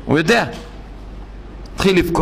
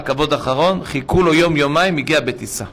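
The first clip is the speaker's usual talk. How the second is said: ordinary talk, nothing marked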